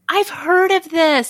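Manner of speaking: excited gasp. Real, not acted. Gasps at new last name.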